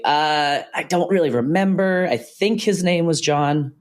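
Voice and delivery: high-pitched, hesitant, drawn-out recall; unsure recollection. Shown as a demonstration. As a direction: raise the pitch as if unsure you remember. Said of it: "my voice will go higher".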